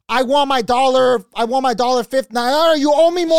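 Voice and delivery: high, very loud demand; irate customer. Retold or imitated. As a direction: yell at the manager like a cheated shopper. Acts out lady shouting at manager.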